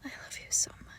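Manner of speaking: soft, breath-only declaration of love; tender whisper. Acted. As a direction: whisper it lovingly, close up. Whispered endearment to pet.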